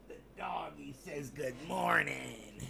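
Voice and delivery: slow whispered voice for the dog. Pretend. Speaking for the dog.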